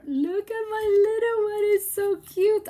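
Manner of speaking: high, loud delighted coo; excited high-pitched fawning; cutesy gushing. Real, not acted. Gushing over her little creation.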